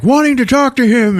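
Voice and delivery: high, loud imitation of eager fans; mock clamoring. Fans crowding round the writer, imitated clamoring.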